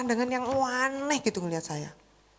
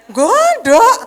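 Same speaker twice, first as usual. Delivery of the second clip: exaggerated seething; 10 semitones higher, 12 dB louder than usual. Real, not acted. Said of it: "jangan pikir ini kata-kata ini tulus".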